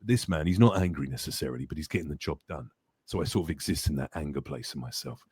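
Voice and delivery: low, gritty, raspy soldier voice; bassy grit, getting the job done; Ghost. Shown as a demonstration. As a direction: drop low and quiet with contained anger. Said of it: "raspy, gritty voice".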